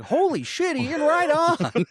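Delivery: high, drawn-out whoop of approval; mock amazed cheer. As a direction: imitate an impressed brother cheering. Brother cheers him on.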